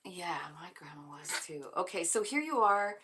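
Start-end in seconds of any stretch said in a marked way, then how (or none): 0.1-1.7 softly whispered answer to spirit; whispered reply. Pretend. Viewer whispering back to grandma's spirit, whispered. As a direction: breathe it like a secret.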